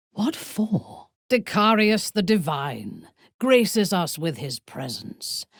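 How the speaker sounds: ordinary talk, nothing marked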